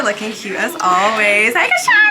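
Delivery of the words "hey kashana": high, loud happy squeal; excited greeting. Real, not acted. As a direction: squeal her name with joy. Greeting friend after show.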